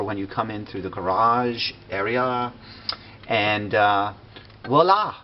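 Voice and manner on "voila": high, loud showy reveal; excited voila. Real, not acted. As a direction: announce it like a magic trick. Unveiling the kitchen with flourish.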